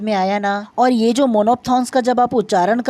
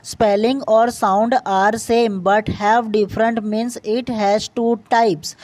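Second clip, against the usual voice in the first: slow dictation; much slower than usual. Shown as a demonstration. Reads the definition to the class.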